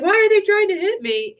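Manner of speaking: high, loud mock-panicked wail; mock alarm. Person rattled by attacking thoughts protests, mock alarm.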